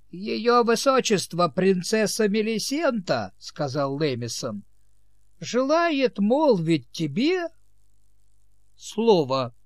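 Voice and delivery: high, slow formal announcement; pompous herald. Acted. Servant formally announces princess.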